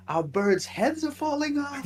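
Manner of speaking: very high, slow goofy voice; cartoonish high-pitched movie imitation; Jim Carrey. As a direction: drag it out in a squeaky dim-witted whine. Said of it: "Jim Carrey's going on about".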